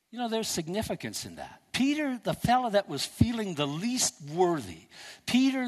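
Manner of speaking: ordinary talk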